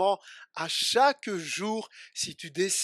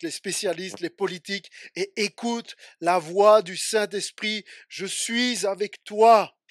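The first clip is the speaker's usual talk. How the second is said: loud, urgent exhortation to the church; full-force preaching; 8 dB louder than usual. Acted. (prédication à pleine voix)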